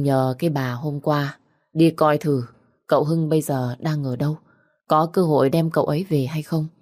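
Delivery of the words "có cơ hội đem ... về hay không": usual speaking voice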